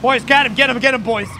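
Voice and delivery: high, loud rallying call; shouted, excited order; urgent shout. Pretend. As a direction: yell to sic the boys on him. Calling out to the boys.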